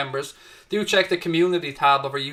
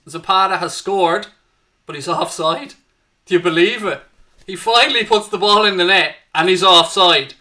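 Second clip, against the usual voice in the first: louder, higher, half laughing at the offside call; excited disbelief; 3 semitones higher, 7 dB louder than usual. Real, not acted. Watchalong host calling a cancelled goal to viewers, excited and incredulous. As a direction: call the late goal, then can't believe it's offside.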